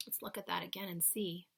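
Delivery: usual speaking voice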